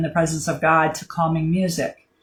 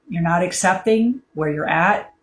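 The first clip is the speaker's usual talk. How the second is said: ordinary talk, nothing marked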